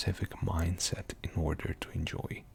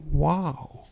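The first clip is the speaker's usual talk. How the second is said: excited wow; 8 semitones higher, 8 dB louder than usual. Real, not acted. Amazed by the game.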